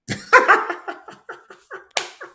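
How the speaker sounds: high, loud whooping laugh; shrieking laugh. Real, not acted. Laughing at his own joke about Nick Scott, high shrieking laugh. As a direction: burst into a squealing laugh.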